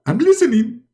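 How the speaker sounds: very high begging voice; pleading Muhammad. Acted. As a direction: beg frantically for forgiveness. Muhammad answering God.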